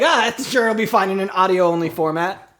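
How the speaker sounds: normal talking voice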